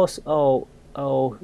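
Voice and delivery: ordinary delivery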